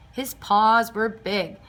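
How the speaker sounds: usual speaking voice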